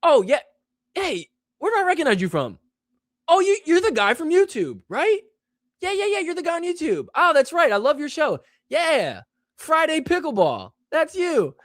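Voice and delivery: high-pitched, eager fan impression; excited fan. Fan spots a YouTube host on open play courts, excited high-pitched mimicry. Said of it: "I'll get somebody that goes".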